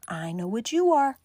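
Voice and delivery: ordinary talk, nothing marked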